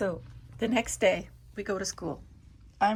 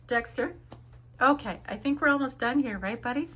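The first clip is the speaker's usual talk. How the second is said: talking to the dog; 6 semitones higher, 6 dB louder than usual. Real, not acted.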